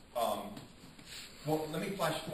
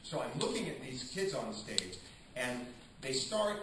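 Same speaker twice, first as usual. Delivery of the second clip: ordinary delivery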